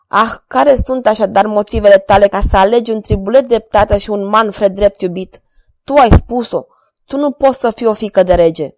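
jealous king ranting loudly, building anger; lightly voiced king, stung by jealousy; a hint of a jealous king. A narrator's reading. King's jealous tirade. (rege gelos, furios, vocea ridicată)

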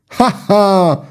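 high mock triumphant ha ha; triumphant laugh. Swordsman laughing at his rival's failed riposte. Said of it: "izbucni în râs Bussy, triumfător".

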